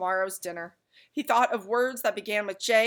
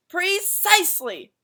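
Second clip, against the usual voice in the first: high, loud, slow emphatic bark; shout; 11 semitones higher, 9 dB louder, slower than usual. A narrator's reading. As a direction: hammer it home. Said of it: "shouted the dog".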